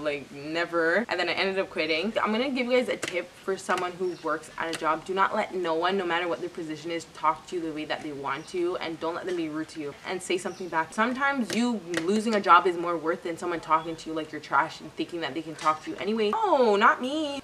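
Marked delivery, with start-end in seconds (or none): 0.0-1.1 slow, dragged-out never; drawn-out refusal. Retold or imitated. Refusing the coworker's apology, slowly. 16.3-17.4 slow, higher, drawn-out no; emphatic refusal. Real, not acted. Telling viewers to refuse rude bosses, slow and emphatic.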